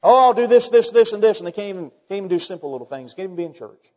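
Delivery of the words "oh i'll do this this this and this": loud, raised, boastful promising; mock the big talker making grand promises. Preacher mimicking Christians who talk big, boastful and loud. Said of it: "Peter talked big".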